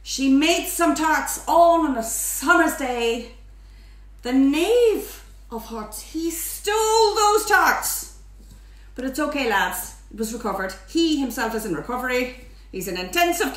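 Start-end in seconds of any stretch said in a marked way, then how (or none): 0.0-8.2 slow, theatrical nursery rhyme; nursery rhyme recital. Acted. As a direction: declaim the rhyme with drawn-out drama. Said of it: "there's a nursery rhyme".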